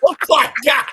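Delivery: loud high laughing outburst; laughing shout. Real, not acted. Friend cracking up at Jordan's jab, loud and laughing. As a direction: burst out laughing in disbelief.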